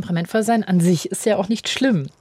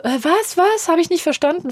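imitating a frail old lady, high and puzzled; mock old grandma, high and confused; confused old woman; 8 semitones higher than usual. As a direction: play a doddering old woman who missed the question. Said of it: "eine alte Frau bin, die langsam ist".

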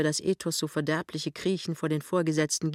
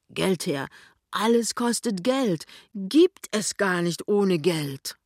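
empört; 4 semitones higher than usual. A narrator's reading. Pinneberg empört sich.

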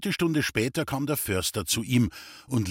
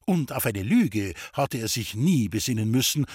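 ordinary delivery

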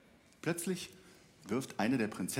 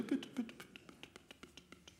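high, fading whispered pleading; trembling voice; 10 semitones higher, 14 dB quieter than usual. Acted. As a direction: beg with a trembling, dying voice. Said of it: "mit zitternder Stimme".

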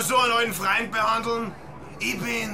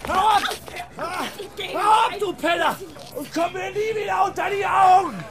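angry yelling; 8 semitones higher than usual. Acted. Jagt Penner wütend weg.

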